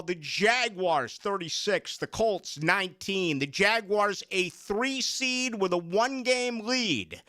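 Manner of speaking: slow, emphatic score recap; slow score read. Real, not acted. Host recapping the Jaguars win, slow and emphatic. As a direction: announce the result with weight.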